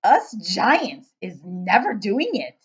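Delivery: booming, slow giant with odd grammar; loud giant voice. Acted. Giant questioning the girl, booming and puzzled.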